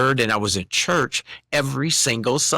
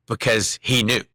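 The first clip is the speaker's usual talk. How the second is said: ordinary talk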